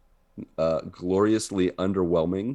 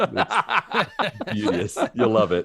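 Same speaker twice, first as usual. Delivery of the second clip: high, slow, drawn-out beauteous; mock admiring; 4 semitones higher, slower than usual. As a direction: gush over it like a salesman. Mock-praising the mosquito bedspread.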